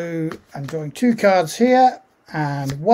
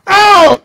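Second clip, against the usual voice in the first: pained yelp; 14 semitones higher, 10 dB louder than usual. Acted. GM voices a scream in scene.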